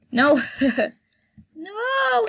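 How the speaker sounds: high, loud cry of no; shrill protest at being robbed; panicked no. Pretend. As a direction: yelp it in mock panic. Panicked customer eats her food.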